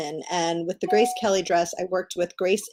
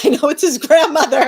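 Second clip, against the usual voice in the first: higher, louder, laughing as she speaks; real giggly outburst mid-story; 8 semitones higher, 10 dB louder than usual. Real, not acted. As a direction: laugh your way through the words. Laughing through her own retold apology.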